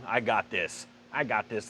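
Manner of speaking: put-on a kid boasting he's got it handled; lightly voiced cocky son telling dad he's fine. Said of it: "the son's getting real cocky and going".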